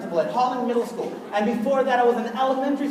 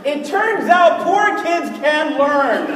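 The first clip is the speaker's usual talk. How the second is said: mock solemn reveal; 2 semitones higher, 3 dB louder, much slower than usual. Mock big reveal to the crowd.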